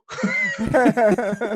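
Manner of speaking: high laugh at own joke; real laugh. Real, not acted. Telling a post office story, real laugh. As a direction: burst out laughing after the punchline.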